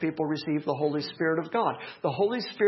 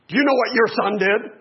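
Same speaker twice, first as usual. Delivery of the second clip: imitating wife; 4 semitones higher, 7 dB louder than usual. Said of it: "walk through the door and hear".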